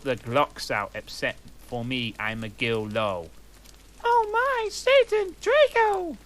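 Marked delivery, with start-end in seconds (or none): 4.0-6.2 very high, loud falsetto cry; hammed-up falsetto scream; mock ecstatic scream. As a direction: shriek it like a campy romance heroine. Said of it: "I screamed".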